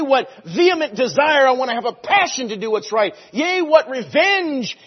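high and loud, driving each line; fervent preaching. Real, not acted. Preacher applying the verse to the church, loud and high. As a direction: preach it with passion.

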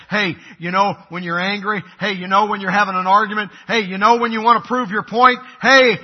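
building to a loud "Hey"; insistent interjection. Acted. The Bible interrupting an arguing couple, insistent and building.

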